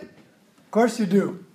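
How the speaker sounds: ordinary talk, nothing marked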